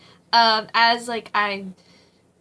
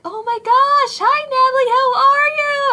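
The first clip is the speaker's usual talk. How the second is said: much higher and louder, gushing friends' greeting; excited greeting; 9 dB louder than usual. Retold or imitated. Friends at work spotting Natalie, gushing high loud excited greeting. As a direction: squeal it out like old friends overjoyed to see her.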